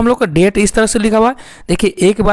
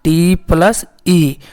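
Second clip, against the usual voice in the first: slow letter spelling; much slower than usual. Shown as a demonstration. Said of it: "हेट में क्या क्या मिलकर बना?".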